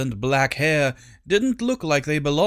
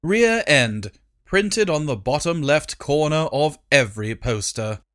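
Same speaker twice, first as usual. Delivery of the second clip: usual speaking voice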